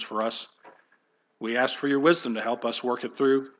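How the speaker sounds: soft, hushed prayer voice; soft, hushed prayer to close the sermon. Acted. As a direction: pray quietly and reverently. Closing prayer.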